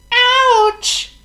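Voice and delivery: very high, loud squeaky yelp; shrill cartoon monkey ouch. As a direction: squeal like a hurt little monkey. Walks into a tree.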